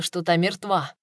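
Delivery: ordinary delivery, nothing marked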